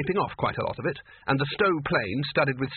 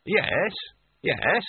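glazed agreeable tone; 5 semitones higher than usual. A narrator's reading. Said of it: "maniacally glazed tones".

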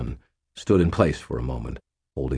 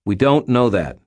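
higher, louder quick contradiction; sharp retort; 6 semitones higher, 5 dB louder than usual. A narrator's reading. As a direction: shoot it straight back, firmly. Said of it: "Daniel shot back".

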